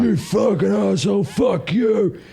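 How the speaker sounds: imitating an aggrieved audience member; angry heckler. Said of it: "this guy stands up and goes".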